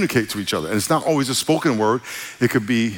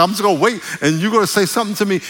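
eager expectant quote; 7 semitones higher, 3 dB louder, faster than usual. Retold or imitated. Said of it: "I was like".

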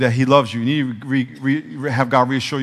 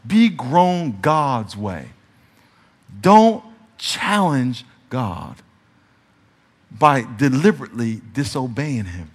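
slow solemn emphasis; 4 semitones lower, slower than usual. Acted. Solemn preaching point.

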